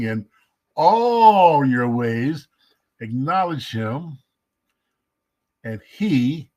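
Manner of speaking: very slow, each word drawn out; real slow. Shown as a demonstration. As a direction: spell the verse out word by word, slowly. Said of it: "I'm gonna say it real slow".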